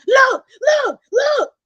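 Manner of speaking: high, loud, insistent yelps as grandma; grandmother's voice. Retold or imitated. Grandmother pointing at the TV, imitated excited yelling. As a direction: imitate grandma urgently demanding attention. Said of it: "I have to do the voices".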